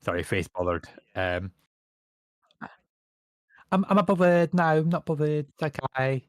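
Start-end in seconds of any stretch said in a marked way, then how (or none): 3.7-6.2 put-on camp Essex accent, raised and louder; lightly camp Essex brush-off. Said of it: "he went full on Camp Essex".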